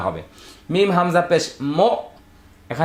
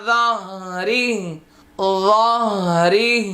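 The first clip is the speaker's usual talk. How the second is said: very slow melodic Arabic chant; Quranic recitation; 3 semitones higher, much slower than usual. Shown as a demonstration. Teacher modelling the verse before explaining, slow chant. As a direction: chant the verse long and sustained. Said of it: "teacher recites Arabic verse".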